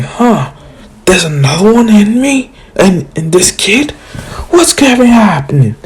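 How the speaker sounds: high, rising, astonished voice; Shukaku voice. Retold or imitated. Shukaku startled to find another of himself, high and amazed. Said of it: "Shukaku's like".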